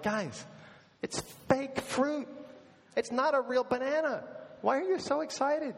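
retelling his own exasperation at friends, high and incredulous; exasperated disbelief. Retold or imitated. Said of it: "I'm like".

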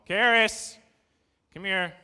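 put-on loud, firm call to a child; stern parental call. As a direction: summon the daughter sharply. Parent calls daughter over.

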